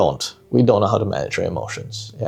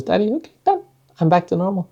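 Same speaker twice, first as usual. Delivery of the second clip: put-on high child voice; 8 semitones higher, faster than usual. Daughter's voice, retold.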